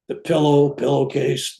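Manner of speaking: ordinary delivery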